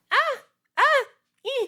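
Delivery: tiny high-pitched squeaks; soft, very high little cries; squeaky mock moans. Shown as a demonstration. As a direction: imitate squeaky overdub moans. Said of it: "They're very squeaky".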